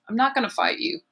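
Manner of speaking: plain reading voice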